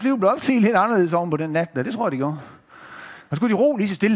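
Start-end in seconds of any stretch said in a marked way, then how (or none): none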